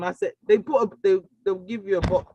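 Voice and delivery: ordinary delivery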